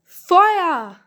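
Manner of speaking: sudden loud cry of fire; shouted alarm. Acted. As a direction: yell it out in alarm. Alarm cry on burning ship.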